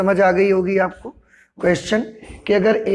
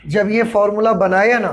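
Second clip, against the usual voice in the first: ordinary talk, nothing marked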